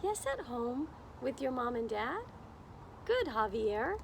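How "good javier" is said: ordinary delivery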